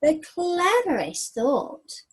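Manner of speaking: slow, hushed bedtime-story reading; story narration. A narrator's reading. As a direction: draw the children into the night scene.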